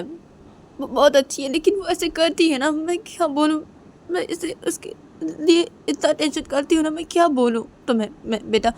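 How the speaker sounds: a mother earnestly urging a match; pleading mother. Acted. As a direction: plead for your daughter, insistent and worried. Voices mother pleading for daughter. (बेटी के लिए मिन्नत करती माँ)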